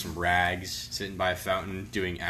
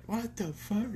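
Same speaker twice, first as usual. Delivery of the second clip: high, soft mock bafflement; imitated stunned disbelief; 12 semitones higher, 5 dB quieter, slower than usual. Friend reacts to the homeless man's scream, imitated disbelief. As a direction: act baffled, pitched up and quietly.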